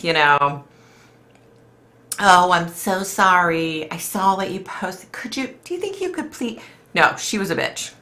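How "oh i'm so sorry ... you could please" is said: mock polite. How the rude lady should have asked, mock polite and sugary. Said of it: "It wasn't like".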